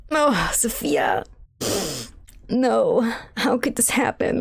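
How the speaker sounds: hammed-up grief, drawn-out then whispery; mock mourning. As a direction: fake a heartbroken lament. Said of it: "all of a sudden we're like".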